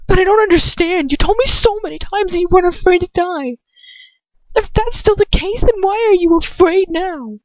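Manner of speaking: high, loud, trembling plea; a girl's voice close to tears. A narrator's reading. Girl confronting her lover, high and trembling.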